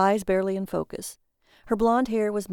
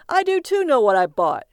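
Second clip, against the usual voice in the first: loud, higher indignant shout; defensive holler to the next room; calling out; 5 semitones higher, 8 dB louder than usual. A narrator's reading. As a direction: call after her in protest. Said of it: "he called out to her".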